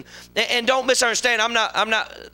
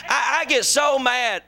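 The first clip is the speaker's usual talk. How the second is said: ordinary talk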